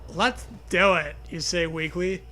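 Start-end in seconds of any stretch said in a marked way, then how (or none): none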